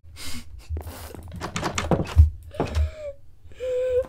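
high breathless laughter; high wheezing laugh. Real, not acted. Laughing at voice changer.

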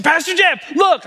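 high, eager call of the pastor's name; a recovering man beaming at his pastor; excited greeting. Retold or imitated. Grateful man hailing his pastor excitedly. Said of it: "He said".

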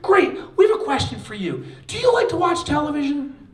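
high, loud, playful asking; cheery researcher. Retold or imitated. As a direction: lead them on with a grin. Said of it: "They said".

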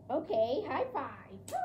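ordinary talk

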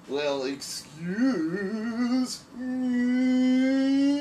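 very slow, high, drawn-out "excuse me"; Steve Martin impression. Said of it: "as Steve Martin once said".

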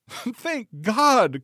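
very high-pitched lady's exclamation; cried-out relief. A narrator's reading. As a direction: cry it high, as the rescued wife. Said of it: "cried she".

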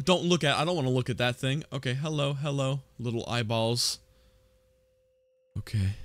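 soft, tense hush. Real, not acted. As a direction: keep your voice down, nervous. Tense, hiding from the animatronic.

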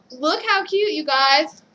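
ordinary talk

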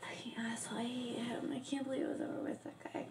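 angry whisper under breath. Acted. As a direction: hiss it bitterly. Bitter muttering after phone call.

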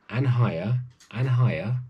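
ordinary delivery, nothing marked

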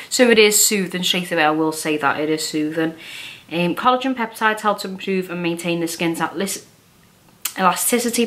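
talking with mask on face; wearing a sheet mask. Real, not acted. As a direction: lips framed by mask. Seen 2: Wet sheet mask on face throughout, mouth hole open; references unmasked.

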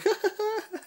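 very high, smitten giggle; giddy giggle. Retold or imitated. Vlogger acting out being charmed by him, giddy giggle. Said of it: "I'm just like".

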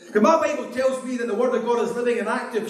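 ordinary delivery

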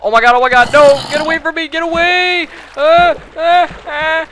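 high panicked screaming; scared shriek. Real, not acted. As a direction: shriek in real fright at a zombie. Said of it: "that scared me".

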